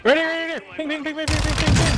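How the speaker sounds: high, rapid calling out; excited callout. Real, not acted. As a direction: point it out urgently. Calls out a ping excitedly.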